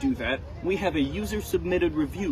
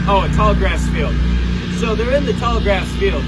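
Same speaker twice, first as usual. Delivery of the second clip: loud, higher, gleeful; excited loud aside; 4 semitones higher, 10 dB louder than usual. Real, not acted. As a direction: cheer over the passing tanks. Excited aside about tanks, loud.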